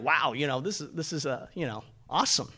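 soft, low retold reaction; understated, trailing-off awe; subdued reply. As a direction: answer the excitement quietly and haltingly. Underwhelmed reply to excited friend's outburst.

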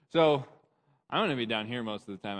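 ordinary delivery

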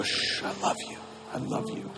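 soft comforting whispered shh, I love you. Pretend. Pastor voicing God comforting a crying person, whispered. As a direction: hush them tenderly like a soothing parent. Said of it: "he can just go".

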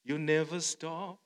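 usual speaking voice

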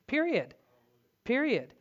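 high, hammered final word; emphatic period. Real, not acted. Preacher insisting on one way to God, emphatic. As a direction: slam the door on the point. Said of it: "There is no other way to God".

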